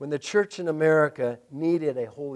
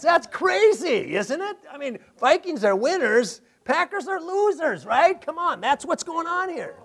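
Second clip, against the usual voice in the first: playful joking; 8 semitones higher than usual. Real, not acted. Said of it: "Sorry about that".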